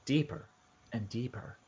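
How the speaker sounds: game master narration. Acted. GM sets eerie scene.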